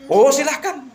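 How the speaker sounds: eager woman's voice. Acted. Voicing the wife in the story.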